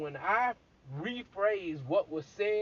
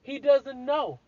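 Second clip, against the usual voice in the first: high, loud, slow insistence; emphatic exclaim; 7 semitones higher, 6 dB louder, slower than usual. Real, not acted. Telling the listener Adam doesn't know, loud and high. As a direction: hammer home that he doesn't know.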